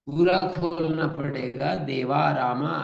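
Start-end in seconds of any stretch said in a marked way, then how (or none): 1.8-2.7 देवा रामा with the final आ drawn out, shown as wrong; wrong open vowels. Shown as a demonstration. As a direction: stretch the end of देवा रामा as a mistake. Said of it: "ऐसा नहीं बोलते हम". (गलत लंबा आ वाला उच्चारण)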